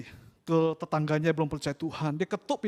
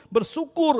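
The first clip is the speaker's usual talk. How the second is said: "bersyukur" cried out much higher and louder; exclaimed; 10 semitones higher, 6 dB louder than usual. Acted. Preacher urging the congregation to give thanks, calling out "bersyukur". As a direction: lift "bersyukur" into a ringing call. Word repeated, escalating.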